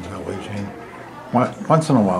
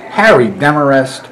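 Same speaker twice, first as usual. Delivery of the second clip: louder, teasing reprimand of her husband; mock scolding; 3 semitones higher, 9 dB louder than usual. Aunt teasingly scolds Harry over who gets the drink, louder mock scolding. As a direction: call him out by full name, half-joking.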